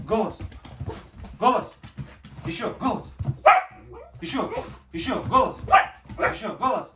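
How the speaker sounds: loud, insistent command to a puppy; firm dog commands. Real, not acted. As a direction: keep urging the dog to bark. (настойчивые громкие команды собаке)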